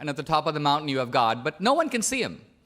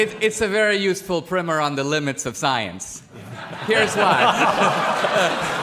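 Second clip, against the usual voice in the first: laughing; slower than usual. Real, not acted. Laughing at opponent's book.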